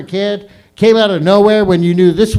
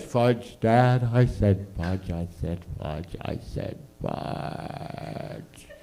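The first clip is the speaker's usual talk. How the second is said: fading fudge; 8 semitones lower, 10 dB quieter, much slower than usual. Retold or imitated. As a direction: lower and softer each time until whispered.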